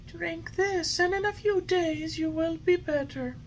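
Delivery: high, gentle fairy voice; fairy, lovingly. A narrator's reading. Fairy lovingly urging the puppet to drink. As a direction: coax the sick puppet tenderly. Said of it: "said lovingly to him".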